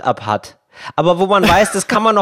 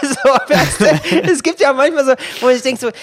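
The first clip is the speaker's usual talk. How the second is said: laughing; 8 semitones higher than usual. Real, not acted. Still laughing, higher.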